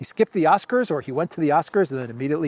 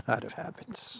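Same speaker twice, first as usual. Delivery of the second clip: softer, chuckling repeat of out of habit; laughing; 3 semitones higher, 7 dB quieter than usual. Real, not acted. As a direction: laugh it out in disbelief.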